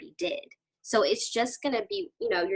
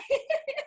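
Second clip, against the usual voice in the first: high teary laugh. Real, not acted. Laughing while fighting tears.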